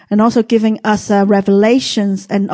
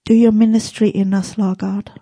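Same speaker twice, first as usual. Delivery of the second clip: hushed church prayer. Acted. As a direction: pray it gently to the Lord. S0 closing prayer.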